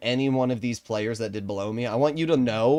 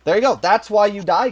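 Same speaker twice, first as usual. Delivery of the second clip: mocking; 7 semitones higher, 7 dB louder than usual. Real, not acted. Mocking rant at bad teammate.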